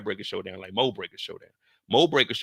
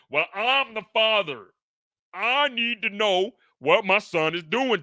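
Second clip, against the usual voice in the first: hammed-up father; 9 semitones higher, 3 dB louder, slower than usual. Father insists on knowing.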